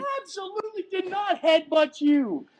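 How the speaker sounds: high, insistent indignant denial. Real, not acted. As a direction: insist you're innocent, voice up high. Denying headbutt accusation.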